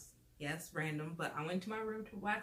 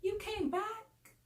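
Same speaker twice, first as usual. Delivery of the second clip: high, slow incredulous question; shocked disbelief; 13 semitones higher, much slower than usual. Retold or imitated. Narrator finds the boyfriend back at the house, high and incredulous. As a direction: stretch it out in disbelief. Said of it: "I'm like".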